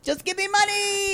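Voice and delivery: very high squealed caricature; mock wailing impression. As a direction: squeal it like a comic plea. Silly imitation continues.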